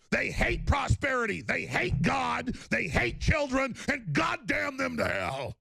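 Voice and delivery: high, slow, shouted rage; real furious shout; emotional outburst. Real, not acted. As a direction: hammer each line in a rising fury. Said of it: "I just get so emotional".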